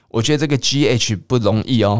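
ordinary talk, nothing marked

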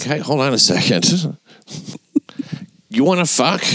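high, playful pickup voice; mock sleazy pickup line. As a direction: tease it like a creep.

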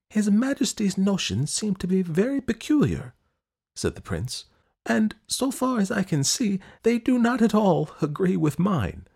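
high, anxious prince voice; puzzled and uneasy, asking questions; perplexed prince. Acted. Prince complains.

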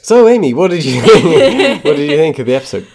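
high, loud, laughing delivery; cracking up mid-question. Real, not acted. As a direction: ask it through real giggles. Asks question while cracking up.

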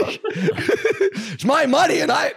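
high squeal broken by laughter; cracking up mid-line, voice squeaking up; laughing squeal. Real, not acted. Cracking up while telling teammates the story, high squeal.

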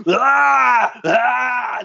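high, loud pained screams; agonized yell. Retold or imitated. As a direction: cry out like a man in agony. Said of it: "yelling out in fucking agony".

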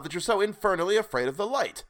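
high, exasperated demand; bursting out. A narrator's reading. Sailor demanding why the light frightens him, bursting out. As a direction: burst out at his odd fear. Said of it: "I burst out".

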